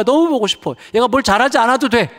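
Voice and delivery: "너무" stressed high and loud in a longing cry. Real, not acted. Father crying out how much he misses his daughter, high and loud. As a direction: cry out "너무" with aching love. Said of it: "막 눈물이 나요".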